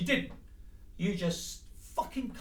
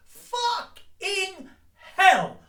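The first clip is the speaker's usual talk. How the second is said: drawn-out furious yell, much louder and higher; outraged shout; 10 semitones higher, 9 dB louder, much slower than usual. Real, not acted. Speaker furious at partner backing out: loud shout. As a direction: bellow it in disbelief, slow.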